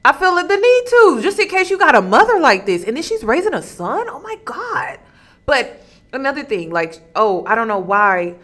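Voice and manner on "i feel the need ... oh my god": high, loud, fast heated rant. Real, not acted. Commentator venting about a mother raising a son, loud and high. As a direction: rev up and vent at full steam.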